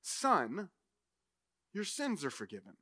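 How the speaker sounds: soft, low and tender; quiet, tender words of forgiveness. Acted. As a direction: say it gently, with space after the first word. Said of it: "and he says tenderly".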